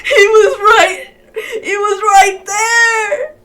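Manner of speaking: slow, high, loud crying wail; wailing lament. Real, not acted. Gamer wails over the character who slipped away, crying slowly. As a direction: grieve the lost pull, sobbing and laughing.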